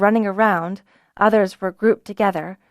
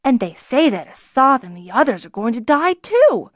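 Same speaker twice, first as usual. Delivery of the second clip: angry protest; 4 semitones higher, 6 dB louder than usual. Acted. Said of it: "Denon protested angrily".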